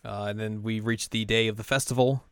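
ordinary talk

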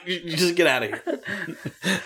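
a booker shooing him away; dismissive Bill Watts. Retold or imitated. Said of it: "He's like".

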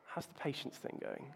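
a quiet word from Jesus, barely voiced; gentle knowing question. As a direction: hush it like a gentle teasing nudge. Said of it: "And Jesus said to me".

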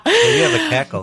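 high, laughing jab. Real, not acted. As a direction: laugh out the tease. Teasing comeback while laughing.

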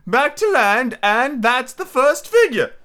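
high-pitched, earnest storybook turtle; mock turtle. Mock turtle telling Alice about sea life, earnestly.

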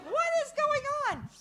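high, squeaky mock-kid question; bewildered kids. Grandkids watching the crying stranger, bewildered. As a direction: imitate astonished children in a high-pitched voice.

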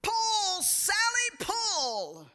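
put-on old farmer hollering at his mule; farmer yelling. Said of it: "the old farmer yelled".

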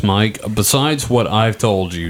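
normal talking voice

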